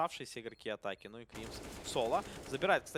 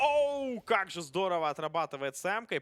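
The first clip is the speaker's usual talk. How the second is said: higher, louder wow; excited exclamation; 8 semitones higher, 8 dB louder than usual. Real, not acted. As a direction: burst out at the frag. Caster reacts to great play.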